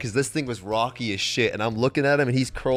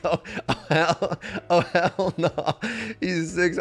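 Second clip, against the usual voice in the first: drawn-out, half-laughing mock horror; appalled refusal; 5 semitones higher, slower than usual. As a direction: refuse in higher-pitched disbelief.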